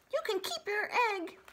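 high, breezy fox. Acted. Fox giving up the egg, high and breezy. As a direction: shrug it off like a sly fox.

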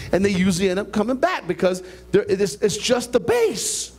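normal talking voice

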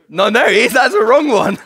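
loud, high, amused outburst; flustered self-correction. Real, not acted. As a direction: cut in hastily on your own mistake. Catches himself naming wrong disciple.

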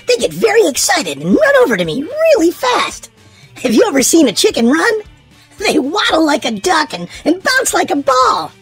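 ordinary delivery

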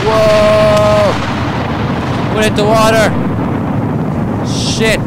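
shocked shout. Real, not acted. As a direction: yell in disbelief. Shocked by explosion.

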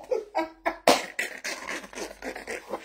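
high, wheezy laugh trailing on; long helpless laugh losing its voice; breathless laughing fit; real laughter. Real, not acted. Laughs at own remarks.

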